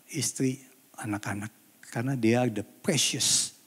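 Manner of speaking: normal talking voice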